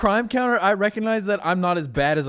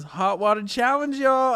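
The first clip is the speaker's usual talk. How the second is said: a slow, lightly voiced little girl's call, a touch higher; little girl announcing; 10 semitones higher, 4 dB louder, slower than usual. Little girl at the stove announcing her stunt to the camera, slow and lightly voiced. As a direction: announce it like a proud little kid, drawn out. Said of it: "at the stove and goes".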